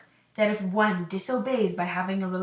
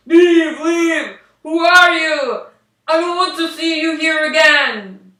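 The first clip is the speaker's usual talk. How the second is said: loud, raised, disgusted yelling; furious shout; 6 semitones higher, 13 dB louder than usual. Acted. Prince shouting at an ugly stranger below his window, furious. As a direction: drive her away with a loud angry yell. Henry repulsed, drives her away.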